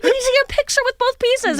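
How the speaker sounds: high-pitched imitation of an eager request; mock-eager high voice; retold eager request. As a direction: pitch it up, keen and insistent. Retelling Heidi's eager request.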